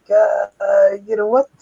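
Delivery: imitating himself easing out of a friendship; mock awkward brush-off. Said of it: "and I was like".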